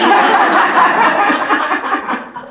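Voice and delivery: loud, high put-on laugh; forced big laugh. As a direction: fake a big laugh for the crowd. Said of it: "Let's try".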